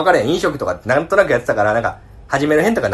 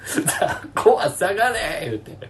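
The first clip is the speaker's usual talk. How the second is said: laughing while quoting; 8 semitones higher, 4 dB quieter, slower than usual. Retold or imitated. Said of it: "むっちゃでかい声で言ったんよ".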